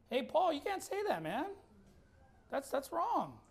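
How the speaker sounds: mock objection. Imagined objector answering the Apostle Paul, in mild mock protest. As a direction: lightly imitate someone timidly objecting.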